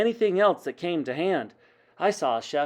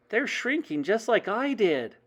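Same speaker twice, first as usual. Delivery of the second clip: higher-pitched, amazed voice; excited child; 7 semitones higher than usual. A narrator's reading. Child excitedly reports witches shrinking.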